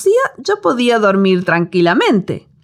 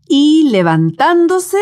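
slow emphatic rise; 6 semitones higher, slower than usual. Acted. Dramatic rise of Jesus.

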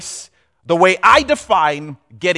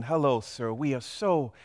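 soft, low, grovelling flattery; bow and scrape to the big boss; hushed obsequious greeting; fawning underling; 5 semitones lower, 8 dB quieter than usual. Acted. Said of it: "the C E O walks in".

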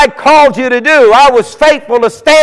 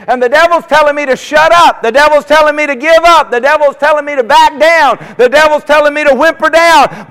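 higher, pressing preaching cadence; rising sermon litany; preaching build; 6 semitones higher than usual. Acted. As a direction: stack each line with force. Preaching crescendo.

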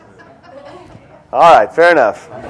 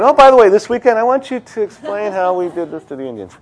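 mock boss giving orders; 7 semitones higher than usual. Teacher mocks the boss who ordered the cartoon, high, laughing. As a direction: ham up a boss casually handing out an impossible job. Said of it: "Can you imagine the guy who had to come up with that cartoon?".

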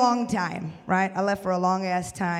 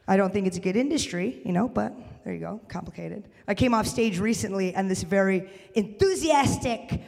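ordinary talk, nothing marked

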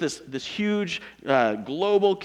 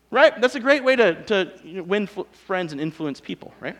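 usual speaking voice

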